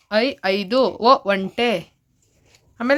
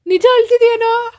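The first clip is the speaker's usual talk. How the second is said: teasing; 11 semitones higher than usual. Real, not acted. Parent teasing crying child.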